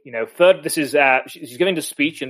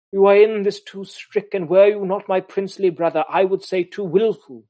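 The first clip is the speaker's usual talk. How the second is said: higher, lofty reading of the Duchess rebuking her brother; haughty Duchess; 6 semitones higher than usual. Acted. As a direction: scold the brother from on high. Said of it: "the Duchess saying".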